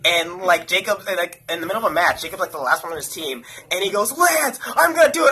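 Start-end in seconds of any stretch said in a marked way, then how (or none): 4.2-5.3 high, louder imitation of an excited friend; acting out a hyped-up teammate; excited declaration. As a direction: announce a daring move, voice pitched way up. Retold excited friend mid-match.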